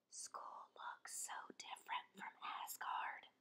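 breathy whispered dragon line. A narrator's reading. Dragon whispering nervously on the first day at school. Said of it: "whispers the dragon".

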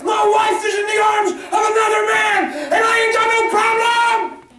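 betrayed husband screaming at God, furious; screamed with hate. Retold or imitated. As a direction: scream it out, full of rage. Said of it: "Said he screamed it with hate".

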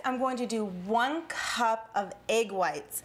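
usual speaking voice

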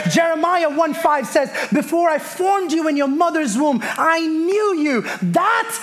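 emphatic scripture proclamation with drawn-out key words; fervent preaching. Real, not acted. Preacher proclaiming scripture for an amen, fervent preaching. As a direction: declare it with mounting conviction, stretching each promise.

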